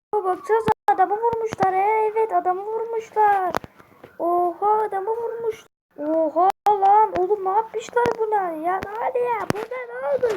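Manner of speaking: high-pitched astonished onlooker; shocked bystander. Acted. Bystanders discover the shot man.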